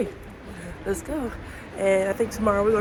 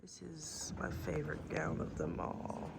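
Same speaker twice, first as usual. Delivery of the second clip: whispering; 3 semitones lower, 17 dB quieter than usual. Real, not acted. Quiet museum gallery.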